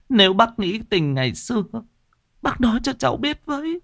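put-on high, tearful begging young woman; pleading widow. A narrator's reading. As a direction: beg him desperately, voice up high. Widow begging the old man.

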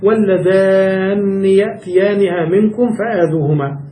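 slow, measured Quran reading; slow recitation. Shown as a demonstration. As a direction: recite the verse deliberately and slowly. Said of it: "وقرأ كذلك". (تلاوة بطيئة متأنية)

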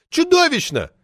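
put-on high, loud indignant shriek of an old party lady; shrill furious official lady yelling; roaring outrage. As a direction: howl it out like an enraged sabre-toothed tigress. Poetess outraged.

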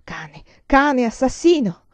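acted bitter, tearful outburst; anguished cursing. A narrator's reading. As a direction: hurl the insult, close to sobbing. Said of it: "i singhiozzi la soffocavano".

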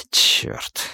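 sharp hissed whisper; hissed curse. Acted. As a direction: hiss it under the breath. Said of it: "прошипел майор".

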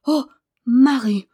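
loud, high, overcome exclamation; emotional outburst, close to tears; half-sobbed cry. A narrator's reading. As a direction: cry out with a touch of a sob. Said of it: "cria-t-il avec une espèce de sanglot".

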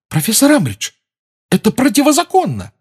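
put-on high, pleading girl's voice; frantic schoolgirl. A narrator's reading. Hermione voiced in story. (испуганная школьница)

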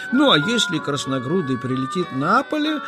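usual speaking voice

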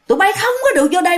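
high-pitched, fast warning cry; retold hollering. Old woman yelling at children outside her door, retold hollering. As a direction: act out an old woman's frantic keep-out. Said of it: "bả la làng lên vậy đó".

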